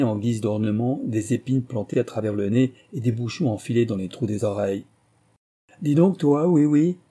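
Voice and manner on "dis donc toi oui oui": loud, high accusing call; accusatory narrated roar. A narrator's reading. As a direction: roar at the cook, voice raised. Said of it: "rugit Harry Whale".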